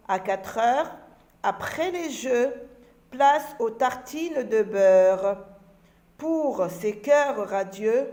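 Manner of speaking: put-on slow, carefully projected children's verse; poem recitation. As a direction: recite it line by line, drawn out for pupils. Recites the same poem again. (récitation de poésie, lente et bien articulée)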